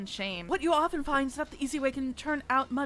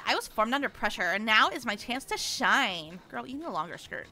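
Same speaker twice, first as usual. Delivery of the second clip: usual speaking voice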